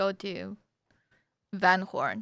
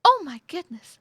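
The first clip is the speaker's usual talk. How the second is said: higher, louder delighted oh my goodness; excited; 8 semitones higher, 8 dB louder than usual. Shown as a demonstration. Dawn hearing good news, excited. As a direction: squeal it happily. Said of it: "She's excited".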